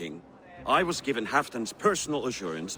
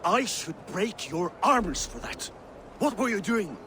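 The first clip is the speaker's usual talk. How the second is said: angry scolding; 7 semitones higher than usual. Acted. Lord rebukes his ally for revealing the plan, angry scolding. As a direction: scold an ally who has crossed you.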